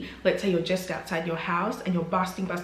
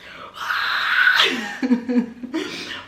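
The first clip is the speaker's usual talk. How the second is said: breathy disbelieving laugh; 4 semitones higher than usual. Real, not acted. Reaction to bad news.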